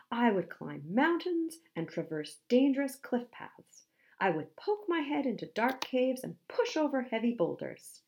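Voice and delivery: puffed-up hero listing brave deeds; Handsome the musk ox, boastful. Acted. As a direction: proclaim bold promises with a flourish.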